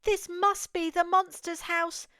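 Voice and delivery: usual speaking voice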